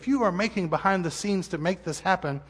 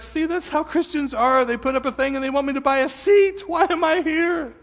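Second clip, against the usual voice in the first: mock skeptical visitor; 8 semitones higher than usual. Pastor voicing a first-time visitor's scoffing complaint. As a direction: play the scoffing newcomer, rising in disbelief. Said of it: "You're like".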